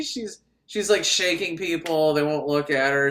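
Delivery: ordinary talk, nothing marked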